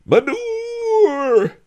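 long, high street vendor's cry; quavering, melancholy shout. A narrator's reading. Old peasant calls his wares, quavering melancholy shout. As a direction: wail it out over the street, drawn out. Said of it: "shouted in a quavering, melancholy voice".

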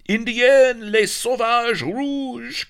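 loud, high French alarm; startled cry. A narrator's reading. Startled pirates spotting an ambush, high French shout. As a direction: shout the warning, stretching the last words. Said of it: "cried the startled pirates".

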